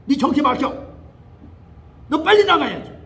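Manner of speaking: loud, rising exorcism command; shouting down the demon; rebuking shout. Retold or imitated. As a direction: cast the spirit out with force.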